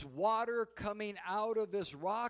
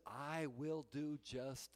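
soft reply; 7 semitones lower, 9 dB quieter, slower than usual. Retold or imitated. Jesus answering gently.

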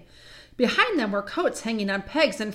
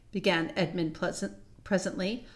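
plain reading voice

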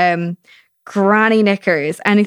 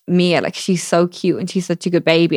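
ordinary delivery

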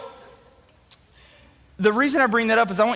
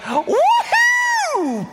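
excited whoop. Retold or imitated. A boy cheering at the bikes under the tree. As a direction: cheer like you just saw your dream bike.

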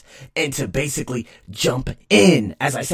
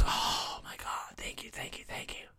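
whispered thanks; 16 dB quieter than usual. Real, not acted. Whispered gratitude.

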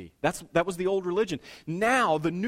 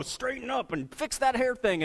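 put-on higher, pinched aside to the kids; parent scolding kids; 7 semitones higher than usual. Parent elbowing the kids at church, mock scolding aside. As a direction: hiss the order under the smile.

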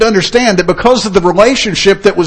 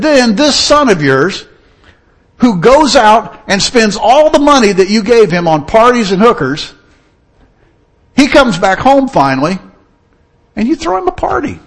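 put-on indignant older son; 6 semitones higher, 4 dB louder than usual. Older son to his father, loud indignant complaint. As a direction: throw your years of loyalty back at him. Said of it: "the older son was indignant".

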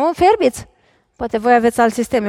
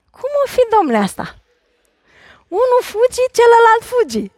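mock amazement; 10 semitones higher than usual. Mock wonder about fleeing couples.

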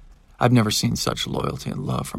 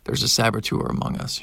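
usual speaking voice

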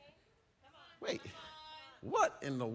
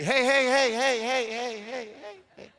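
louder, higher pleading then fading; mock frantic calm-down; 5 semitones higher, 3 dB louder than usual. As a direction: wave them off, hammed up. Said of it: "you say".

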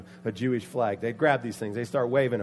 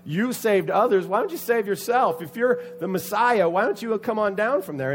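high, loud taunting questions; acted scornful heckling; mocking ridicule; 7 semitones higher, 6 dB louder than usual. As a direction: jeer at a helpless victim. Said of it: "They mock him. They ridicule him".

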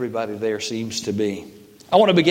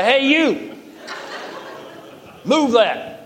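bossy mock order; 11 semitones higher, much slower than usual. Preacher mocking how he orders his son-in-law around, high and laughing. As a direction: bark it out playfully, half laughing. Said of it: "So I say".

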